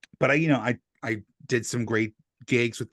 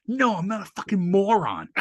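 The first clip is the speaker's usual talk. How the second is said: mock indignant retort; 9 semitones higher, 4 dB louder than usual. [name] retorts.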